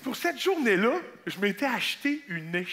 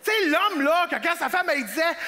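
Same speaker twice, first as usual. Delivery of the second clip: ordinary talk, nothing marked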